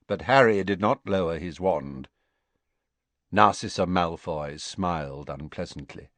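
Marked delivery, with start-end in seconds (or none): none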